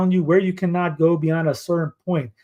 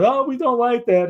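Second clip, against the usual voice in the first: higher-pitched imitation of objectors; mock complaint; 7 semitones higher than usual. Mimicking the fake right objecting, mock complaint. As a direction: pitch it up as a mocking quote. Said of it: "the fake right going".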